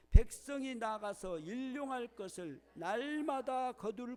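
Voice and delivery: loud, measured congregational Bible reading; scripture read-aloud. Real, not acted. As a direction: lead the verse aloud, drawing out the ending. Leads next verse reading. (크고 또박또박한 성경 봉독)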